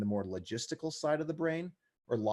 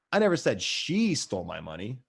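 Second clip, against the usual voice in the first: "she" held long and pitched up; stress on she. Shown as a demonstration. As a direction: point the finger with a stressed "she". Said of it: "depending on where I put the emphasis".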